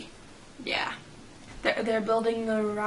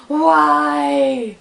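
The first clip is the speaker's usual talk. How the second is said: hammed-up anguished cry, much louder and higher; dramatic movie wail; 4 semitones higher, 11 dB louder than usual. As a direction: wail like a mourner in a sad movie. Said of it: "the dramatic people in sad movies".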